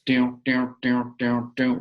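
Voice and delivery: bouncy imitated hop noises; hopping sound. Shown as a demonstration. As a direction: voice each hop as an evenly repeated boing.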